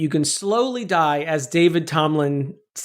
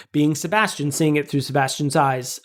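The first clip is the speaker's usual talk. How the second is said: ordinary talk, nothing marked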